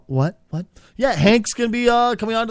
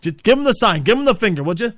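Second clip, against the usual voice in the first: usual speaking voice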